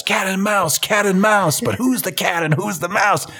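high-pitched mock freak-out; mock shouting. [name] freaking out in front of Rupert, mock shouting. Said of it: "And he just shouts".

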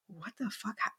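breath-only, whispered disbelief; stunned whisper. Acted. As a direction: whisper it as if too stunned to speak. Acted stunned reply to Darius.

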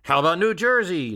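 high mock shout from the floor; delegate's shout. Delegates shout suggestions at Bryan in a high heckling call. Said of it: "delegates shouted".

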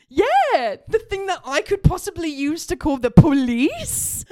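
incredulous sarcasm. Retold or imitated. Daughter refuses to hand over her phone, loud sarcasm. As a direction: make the absurdity obvious, loud and high. Sarcastic reply to dad's request.

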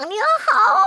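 high, bright gushing praise; sweet praise. Retold or imitated. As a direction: gush it up high like a cheerleader. Imitating Xiaoyue praising everyone. (甜甜地夸人)

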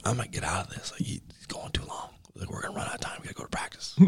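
hushed whisper to a teammate. Retold or imitated. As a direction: whisper so the coach can't hear. Whispering to teammate in film room.

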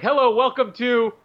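ordinary talk, nothing marked

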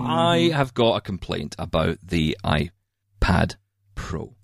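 very slow, with long pauses; dramatic announcement. Real, not acted. Host opening a rant to co-hosts, slow dramatic announcement. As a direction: draw it out, pausing hugely before the last word.

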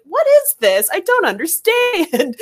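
confused dad. Retold or imitated.

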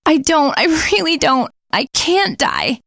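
high, pleading, near tears; desperate. Acted. Nikki begging her friends, desperate and high. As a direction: beg not to die. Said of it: "she said desperately".

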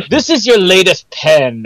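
fussy briefing officer. Retold or imitated. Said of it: "and Q's like".

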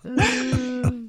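laughing through the words. Real, not acted. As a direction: giggle it out, amused. Laughing at her own joke.

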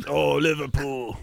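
high, slow mock British cheer; British guy. British guy cheering his football team, slow mock British. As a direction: draw it out like a British fan. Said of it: "your British guy".

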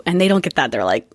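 ordinary delivery

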